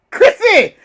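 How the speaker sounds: laughing out a name, high; calling the name through laughter. Acted. Friends reading chat aloud, laughing the name.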